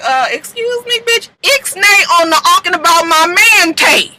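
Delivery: high, indignant mock diva; sassy Brooke. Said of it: "Brooke was like".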